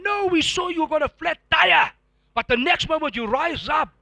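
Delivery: family stunned by his recovery, amazed and loud; amazed onlookers. Retold or imitated. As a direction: sound like people who can't believe it. Amazed family describing his comeback.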